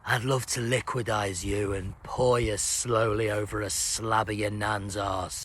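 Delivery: low, slowing creepy musing to a cow; weirdo addressing a cow. Acted. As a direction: savour it in a low, soft put-on voice. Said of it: "looks at a cow and goes".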